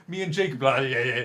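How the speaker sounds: put-on drunken slur. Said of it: "The one you did when you were drunk".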